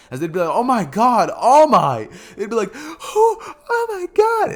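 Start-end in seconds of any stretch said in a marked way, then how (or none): 0.5-2.1 loud, high, badly faked amazement; fake shock. Two students pretend shock at All Might, badly faked. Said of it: "it's just so clear that they're both faking it". 2.7-4.5 very high, squealing mock amazement; fake shock. Two students fooling their teacher, hammed-up fake shock. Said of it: "it's just so clear that they're both faking it".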